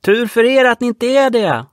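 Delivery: high, loud mocking retort; taunting reply. Boy on the boat taunting the man on the yacht. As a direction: jeer back at him. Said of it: "svarade pojken på renen".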